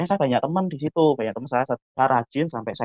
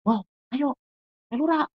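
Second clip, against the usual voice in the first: much higher-pitched mock friend voice; acted invitation; 13 semitones higher than usual. Friend inviting him along to church, high acted voice. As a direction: pitch it way up, urging a pal along.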